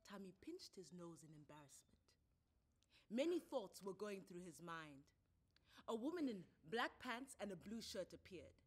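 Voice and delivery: plain reading voice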